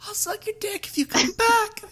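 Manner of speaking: very high falsetto; hammed-up falsetto siren; mock siren call. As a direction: lure them in a squeaky high voice. Mock siren calling sailors.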